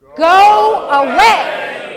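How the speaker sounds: loud, supported "go away" from the belly; strong belly voice. Shown as a demonstration. Coach leading class in telling a bear off, strong belly-voice shout. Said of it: "I want you to speak from the belly".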